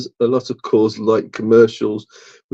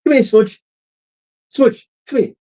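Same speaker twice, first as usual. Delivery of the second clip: calling the cat; 11 semitones higher than usual. Real, not acted.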